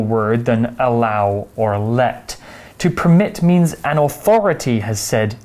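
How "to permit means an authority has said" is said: normal talking voice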